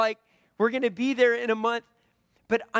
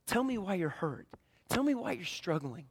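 much softer, gentle and intimate; tender invitation; 7 dB quieter than usual. Retold or imitated. God leaning down to a hurting listener, soft and tender. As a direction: lean in close and invite them to open up. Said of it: "God leaning down to us and saying".

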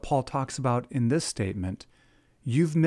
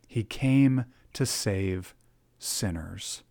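slow, deliberate; 4 semitones lower, slower than usual. Acted. Preacher slows for key phrase.

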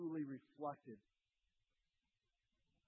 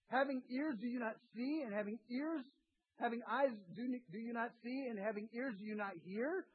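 higher and louder, hammering each question; preaching at full force; 7 semitones higher, 4 dB louder than usual. Acted. Preacher voicing Jesus pressing the disciples, full force. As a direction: drive every question home.

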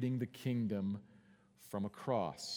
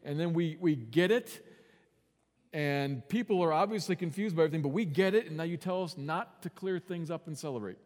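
Peter complaining; 5 semitones higher, 6 dB louder than usual. Retold or imitated. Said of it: "Peter might say".

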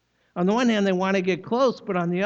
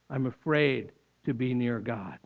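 mildly fearful; 6 semitones lower, 3 dB quieter than usual. Shown as a demonstration. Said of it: "this whole approach avoidance thing".

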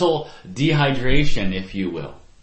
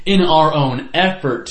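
"in our own effort" punched louder, word by word; stress on "in our own effort"; 4 semitones higher, 7 dB louder than usual. Real, not acted. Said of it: "I want to really stress that".

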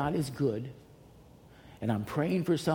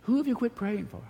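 pitched-up repeat; 10 semitones higher than usual. Real, not acted. Repeated question, pitch jumps up.

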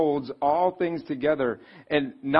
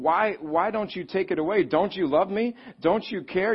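anguished prayer; 5 semitones higher than usual. Retold or imitated. Voicing a hurting believer's prayer.

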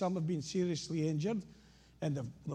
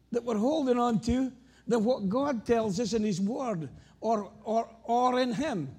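loud, emphatic preaching; 5 semitones higher, 4 dB louder than usual. Acted. Preacher urging the congregation, louder preaching. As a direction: drive the lesson home to the congregation.

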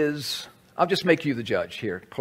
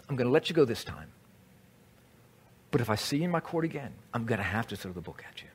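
quiet warning; 4 semitones lower, 9 dB quieter, much faster than usual. Retold or imitated. Judge quietly warns friend.

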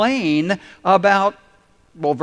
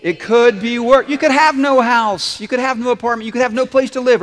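1 loud; 6 semitones higher, 5 dB louder than usual. Shown as a demonstration. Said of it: "can we say 'em again?".

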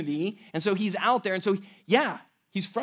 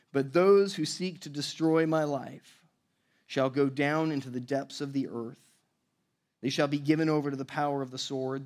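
lower, measured reading of the psalm; scripture reading; 5 semitones lower than usual. Acted. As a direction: read the verses with weight, like Scripture. Reads the psalm aloud.

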